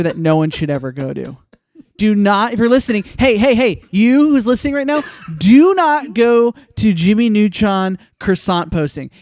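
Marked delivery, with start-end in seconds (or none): 2.0-9.1 loud, higher, urgent plea with a laugh in it; emphatic warning. Real, not acted. Host warns listeners off a bad page, loud and emphatic. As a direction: call out a mock-grave warning to everyone listening.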